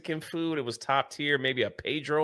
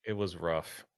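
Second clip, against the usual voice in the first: mock heartbroken; 9 semitones lower, 12 dB quieter than usual. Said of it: "I was heartbroken and shattered".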